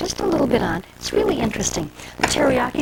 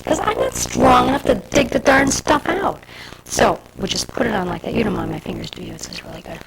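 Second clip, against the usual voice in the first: ordinary delivery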